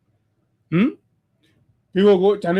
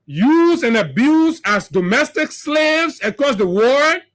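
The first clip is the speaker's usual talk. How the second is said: impassioned oration; 5 semitones higher than usual. Acted. Speaker rallies Liberians against the ruling party, impassioned oration. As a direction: build it like a rally speech.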